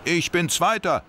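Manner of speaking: calling out. Acted. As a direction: shout out the placing. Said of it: "rief Max".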